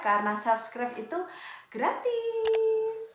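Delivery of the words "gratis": high, long held final syllable; much higher, drawn-out cheery plug; playful drawn-out call. Real, not acted. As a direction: sing out the word, stretched and high. Playful call to subscribe for free.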